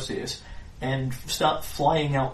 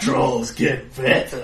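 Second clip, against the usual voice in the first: slow dim troll voice; 4 dB louder, slower than usual. Acted. GM repeats troll line.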